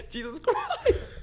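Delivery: high, slow "Jesus Christ" through laughter; stunned laugh; slow laughing disbelief. Real, not acted. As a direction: drag it out, laughing.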